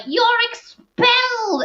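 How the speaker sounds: very high, drawn-out mock proclamation; hammed-up dismissal, stretched last word; outraged pronouncement. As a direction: wail the verdict theatrically. Retold headmaster pronouncing expulsion.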